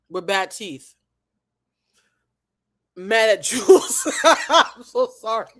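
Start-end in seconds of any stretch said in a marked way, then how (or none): 2.9-5.5 slow words through laughter; laughing. Real, not acted. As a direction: giggle through the apology.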